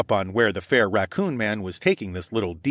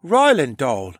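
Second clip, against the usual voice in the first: put-on young boy voice; 5 semitones higher, 7 dB louder, slower than usual. A narrator's reading. Boy character voice.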